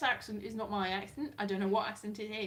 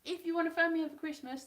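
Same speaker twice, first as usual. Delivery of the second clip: quoted interviewee; 6 semitones higher, 3 dB louder than usual. Retold or imitated. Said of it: "the one that I interviewed in person said".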